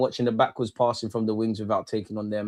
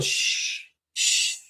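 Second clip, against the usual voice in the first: shushing. Real, not acted. Hushing a viewer in chat.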